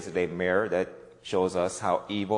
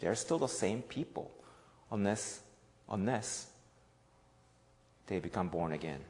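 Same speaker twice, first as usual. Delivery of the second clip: hushed preaching; 2 semitones lower, 4 dB quieter than usual. Acted. Pastor pleading softly about changed hearts. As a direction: draw them in quietly.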